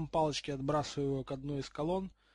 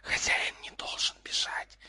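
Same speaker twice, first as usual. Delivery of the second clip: eerie unvoiced snake whisper; hissing three heads; 3 dB quieter than usual. A narrator's reading. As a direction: hiss it like three snakes at once. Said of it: "шипели все три головы одновременно".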